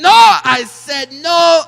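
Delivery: furious loud yell; angry shout. Pretend. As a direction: roar it at them. Scolding toys, huge shout.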